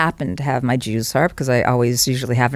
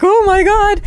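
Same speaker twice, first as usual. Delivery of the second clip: mock horrified gasp. Actors reacting in horror.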